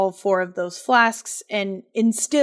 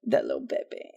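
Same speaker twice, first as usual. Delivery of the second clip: very low, creaky hushed echo; sad murmur; 7 dB quieter, faster than usual. Real, not acted. Storyteller echoing the listener about the baby, sad murmur. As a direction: echo it softly, nearly voiceless.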